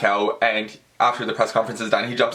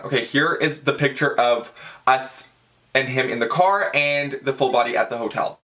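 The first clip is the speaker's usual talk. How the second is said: ordinary delivery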